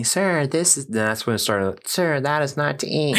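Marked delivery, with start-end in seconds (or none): 0.0-0.9 higher-pitched imitation of a saleswoman; condescending correction. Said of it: "when she was saying that to me". 1.9-3.2 high-pitched mock politeness; condescending correction. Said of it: "Did she say it so condescendingly?".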